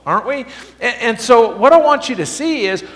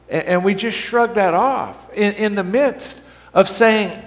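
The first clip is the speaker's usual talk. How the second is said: ordinary talk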